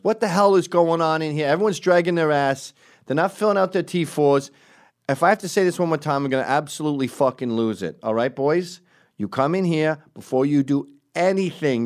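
put-on loud, high New York accent rant. Captain scolding detectives over paperwork, imitated New York accent. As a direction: bawl them out in an exasperated New York accent. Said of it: "she has that New York accent".

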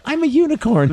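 hammed-up high, girly line; a mocking very high put-on voice. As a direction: squeak it like a dreamy woman. Imitating the sixth wife.

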